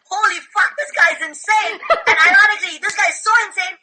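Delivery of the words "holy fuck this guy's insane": incredulous. Retold or imitated. Group reaction to bizarre claim.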